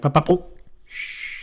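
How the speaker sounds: pecking noise cut off by a hush; woodpecker falling silent. Retold or imitated. Woodpecker going silent as walker passes, mimicked. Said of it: "they hear 'em like".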